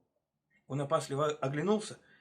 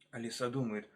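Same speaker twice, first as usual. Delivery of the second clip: ordinary delivery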